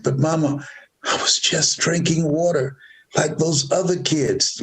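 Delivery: a hint of an innocent boy explaining; protesting child. Boy explaining himself to mother, lightly voiced child protest.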